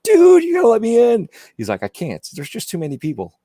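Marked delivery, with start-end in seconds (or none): 0.0-1.3 retold pleading shout. Same plea retold again. 1.8-3.3 soft, mild imitation of a friend; retold refusal. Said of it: "he said it with a British accent".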